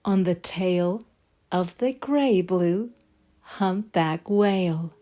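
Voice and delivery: ordinary delivery, nothing marked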